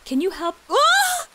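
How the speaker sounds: high shrieking cry for help; hammed-up scream for rescue; fluttering cries for help. Fake damsel screams for help.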